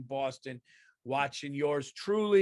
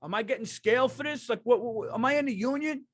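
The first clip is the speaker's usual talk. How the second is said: put-on high-pitched, indignant Italian kid actor; hammed-up rant, pitched way up; mock yelling; 9 semitones higher, 3 dB louder than usual. Italian kid actor to the director after retakes, mock yelling. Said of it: "he'd start yelling at the director".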